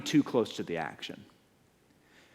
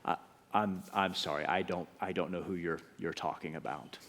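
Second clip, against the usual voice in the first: lower, softer, hushed brush-off; nervous denial; 6 semitones lower, 9 dB quieter than usual. Acted. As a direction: deny it without drawing attention.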